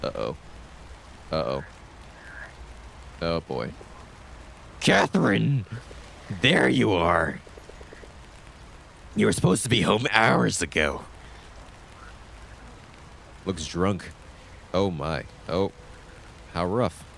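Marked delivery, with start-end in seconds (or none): 4.8-11.0 loud, scolding parent yell; furious mom calling her daughter home; angry yell. Acted. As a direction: shout her down from the car.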